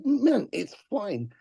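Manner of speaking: slow, soft let-it-go reply; slow gentle forgiveness to an apologizing fan; reassuring. Retold or imitated. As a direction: ease him off the hook, slowly.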